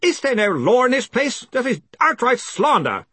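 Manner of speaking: high, indignant outcry; shouted. A narrator's reading. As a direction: shout your outrage at the room. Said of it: "shouted Stoley".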